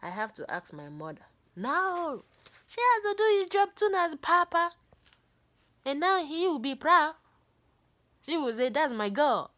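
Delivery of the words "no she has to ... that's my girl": much higher and louder, eager protest; loud insisting. Retold or imitated. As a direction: burst out defending her, high and loud. Sibling insists loudly.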